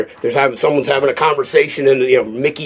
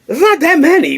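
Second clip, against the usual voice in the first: mock high voice; 4 dB louder than usual. Retold talk with director.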